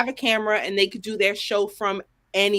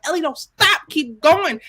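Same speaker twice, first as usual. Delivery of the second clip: raised, higher call urging her on; retold cheering at a show, louder; yelling at the TV; 7 semitones higher, 6 dB louder, slower than usual. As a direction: shout encouragement at the screen. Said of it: "I was like".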